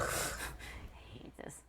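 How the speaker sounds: barely audible whisper; whispered complaint. Acted. Number one son laments his lot, tiny whisper. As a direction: breathe it out in mock despair.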